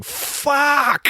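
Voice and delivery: very high-pitched imitated cry of pain; mock pained yelp. Film fan imitates man hit by a can, high mock yelp. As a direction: yelp it like someone just struck. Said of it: "getting hit in the head with the can going".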